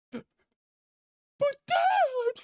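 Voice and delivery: very high, loud strained squeal; hammed-up wail of a stabbed daughter; mock anguished cry. Stabbed daughter cries out, acted.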